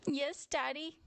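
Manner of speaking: put-on high-pitched child voice; little bear child. A narrator's reading. Child answers stern daddy.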